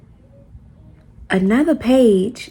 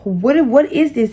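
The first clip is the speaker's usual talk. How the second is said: confronting him; 4 semitones higher than usual. Retold or imitated. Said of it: "screenshotting his messages to him like".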